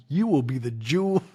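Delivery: normal talking voice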